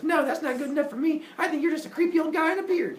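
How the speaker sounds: high-pitched mock scolding; indignant refusal. Semele doubts Zeus's proof, indignant. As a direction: tell him off in a high voice.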